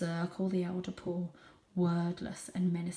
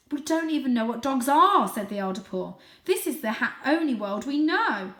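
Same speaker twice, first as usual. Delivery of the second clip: put-on loud old cat; 6 semitones higher, 7 dB louder than usual. Elder Paul arguing.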